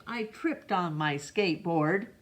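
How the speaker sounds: usual speaking voice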